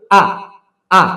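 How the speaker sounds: আ with a sharp, hard onset; sharp hamza. Shown as a demonstration. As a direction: strike আ crisply and let it drop. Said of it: "এটা তীক্ষ্ণ". (তীক্ষ্ণ, sharp আ)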